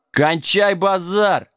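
high-pitched drawn-out rebuke; sharp order. A narrator's reading. Jack ends the argument, snapping. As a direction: cut off the squabble. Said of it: "прикрикнул Джек".

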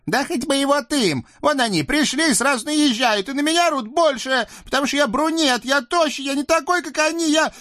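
very high squeaky devil voice, pleading; a scrawny devil begging off; whiny little devil. Acted. Наум жалуется. (писклявый чёрт)

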